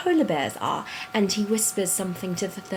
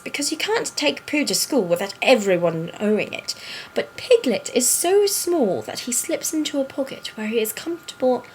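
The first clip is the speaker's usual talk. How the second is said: put-on storybook reading in an affected British accent. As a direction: read it aloud like a British storyteller. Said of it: "do it in that accent".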